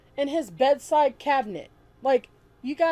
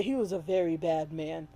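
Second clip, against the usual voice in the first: emotionless mock quote; 5 semitones lower, 5 dB quieter than usual. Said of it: "there's no disgust in his voice".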